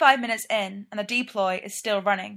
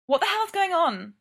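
alarmed; 8 semitones higher than usual. A narrator's reading. Startled shout on arrival.

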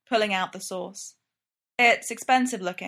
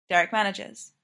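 ordinary delivery, nothing marked

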